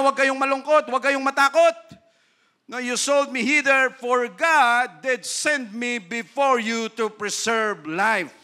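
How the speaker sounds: loud, slow solemn Bible reading; scripture reading. Acted. Pastor reading Genesis aloud to the church, in a solemn, ringing voice. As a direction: proclaim the verse to the congregation.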